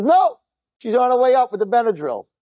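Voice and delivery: high, exclaimed reply; retold exasperation. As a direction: act out the bad news in a high voice.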